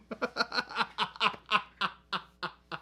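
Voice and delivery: long staccato cackle. Real, not acted. Streamer teasing Chris over the mic, long staccato laugh. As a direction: laugh it out in a string of bursts.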